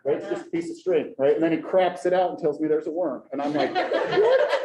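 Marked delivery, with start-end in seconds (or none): 3.7-4.5 stunned. Retold or imitated. Dad learns worm was string.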